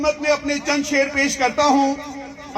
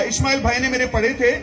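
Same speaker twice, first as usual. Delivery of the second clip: normal talking voice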